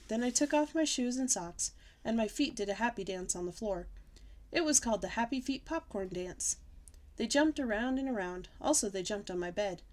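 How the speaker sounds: ordinary delivery, nothing marked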